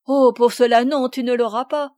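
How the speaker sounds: loud cry of protest; exclaiming. A narrator's reading. Madeleine refuses to let Sophie take the lazy donkey, loud exclamation. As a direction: burst out loud to stop her. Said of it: "s'écria Madeleine".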